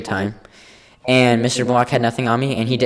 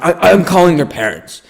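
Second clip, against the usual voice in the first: higher-pitched mock yell; principal yelling; 7 semitones higher, 4 dB louder than usual. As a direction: imitate the principal threatening a student. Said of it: "he just keeps yelling at me".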